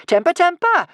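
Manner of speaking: high, loud mocking scold. A narrator's reading. Kamikaze ducking Norbert's axe, high mocking scold. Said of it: "she scolded".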